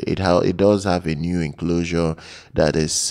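ordinary delivery

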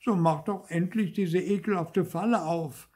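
high, impatient demand; crying out. A narrator's reading. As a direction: demand release in a high, exasperated voice. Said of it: "schrie Zäpfel". (hoch und ungeduldig rufend)

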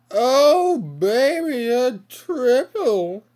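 high, loud hype yell; excited shout. Real, not acted. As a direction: celebrate the triple kill. Triple kill again.

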